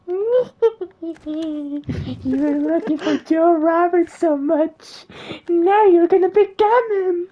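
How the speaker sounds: very high, very loud cackling witch; shrieking triumphant witch; evil witch voice. Acted. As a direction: screech it like a gloating hag. Witch gloats over transformed victim.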